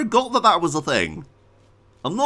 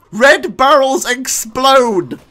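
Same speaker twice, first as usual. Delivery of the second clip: loud, high, emphatic complaint; exasperated shouting; 9 semitones higher, 10 dB louder, slower than usual. Real, not acted. Player lecturing the game about barrels, exasperated shouting. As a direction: shout it out slowly like an obvious rule. Argues with the game's logic.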